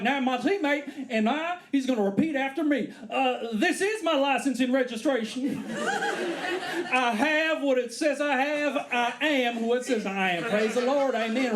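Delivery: high, slow, solemn recital; preacher-style recital. Retold or imitated. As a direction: lead the cop in a creed. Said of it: "He was like".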